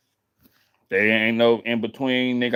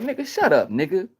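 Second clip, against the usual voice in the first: mock snap; 7 semitones higher than usual. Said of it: "I'm like".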